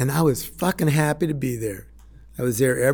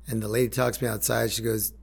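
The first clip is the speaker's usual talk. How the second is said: ordinary talk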